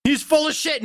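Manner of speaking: yelling high in outrage; angry shout. Real, not acted. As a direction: shout it in disbelief. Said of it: "ranting about accents".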